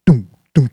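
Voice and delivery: loud punched dunk sounds; impact thuds. A narrator's reading. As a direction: hit each dunk like a blow.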